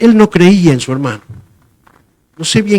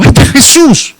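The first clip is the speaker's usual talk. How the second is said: high, loud, excited cry; a disciple sure he sees Jesus on the wall; eager voice spotting a vision; excited pointing out; 10 semitones higher, 4 dB louder than usual. Pretend. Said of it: "y digo".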